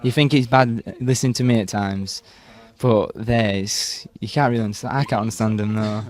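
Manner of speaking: ordinary talk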